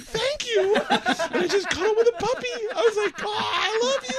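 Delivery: cooing while laughing. Retold or imitated. Bert cuddling the puppy, high giggly coo.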